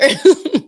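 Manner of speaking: high, loud burst of laughter; real giggle fit. Real, not acted. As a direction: crack up trying to get the story out. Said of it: "oh my gosh, this is so funny".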